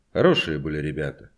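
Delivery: ordinary delivery, nothing marked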